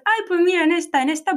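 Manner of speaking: high eager mock-mom voice; imitating mom. Mother in the car spotting coves, high and eager. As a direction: play the mother pointing out a cove.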